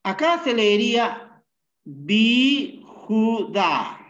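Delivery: bi Judá said slowly and clearly; slow, careful reading of bi Judá; reading it aloud. Shown as a demonstration. Teacher answers a student's question, reading slowly. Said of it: "¿Cómo se lee?".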